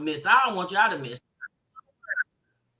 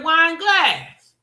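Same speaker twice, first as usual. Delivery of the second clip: incredulous retort; 6 semitones higher, 6 dB louder than usual. Retold or imitated. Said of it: "I said".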